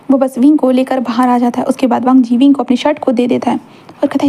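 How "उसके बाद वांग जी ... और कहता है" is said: ordinary delivery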